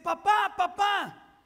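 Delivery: put-on frantic child yelling for his father; child calling out. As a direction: shout for dad through the smoke. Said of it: "empieza a gritar de regreso".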